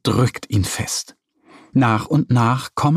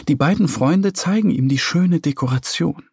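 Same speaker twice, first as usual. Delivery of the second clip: ordinary delivery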